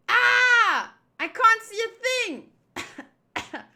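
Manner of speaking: high wail, stretched-out words; scream, then cry out in a panic; panicked scream. Acted. Crew blinded by smoke, high panicked cry.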